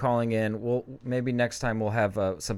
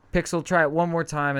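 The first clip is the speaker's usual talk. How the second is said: calling out; 7 semitones higher, 5 dB louder than usual. Real, not acted. Calling out to a pet.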